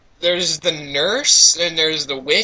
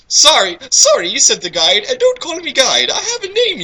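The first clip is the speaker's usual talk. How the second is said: huffy retort; 10 semitones higher than usual. Acted. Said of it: "character retorts to V".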